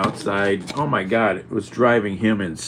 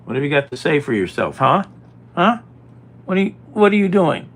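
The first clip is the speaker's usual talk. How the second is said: loud, fast mock telling-off, higher pitched; mock scolding; 7 semitones higher, 8 dB louder than usual. Real, not acted. Scolding the cat for eating the potato salad, loud and fast.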